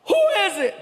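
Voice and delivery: high-pitched yell. Acted. Man answering a knock on the door, yelling. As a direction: call sharply through the door in irritation. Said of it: "He yells".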